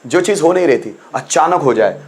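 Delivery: usual speaking voice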